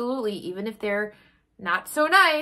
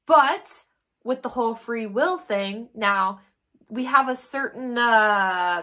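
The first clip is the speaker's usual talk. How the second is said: ordinary talk, nothing marked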